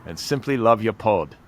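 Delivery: hammy Aussie letter read; Australian accent. Shown as a demonstration. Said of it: "It kind of started out Australian".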